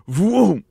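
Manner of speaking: loud, high falling "vroom"; swooping sound effect; whooshing noise. Retold or imitated. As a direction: imitate a sudden rush of energy. Imitates life force rushing back.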